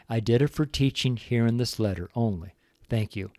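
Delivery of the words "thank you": normal talking voice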